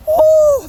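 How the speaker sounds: sharp high woo from cold rain; loud yelp at icy rain; shocked yelp. Real, not acted.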